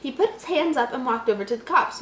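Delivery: ordinary delivery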